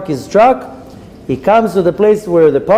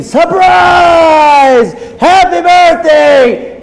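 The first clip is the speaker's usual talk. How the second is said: high, loud shouted greeting; surprise party shout; 13 semitones higher, 6 dB louder, much slower than usual. Retold or imitated. Fifty guests shouting at the birthday man, excited shout. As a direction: yell it like a crowd springing a surprise.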